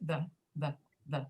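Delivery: "the" said "thuh", each one separate; article before consonants. Shown as a demonstration. Teacher modelling the article before consonants, "the" as "thuh". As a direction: repeat "the" as a short "thuh". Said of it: "we always pronounce it".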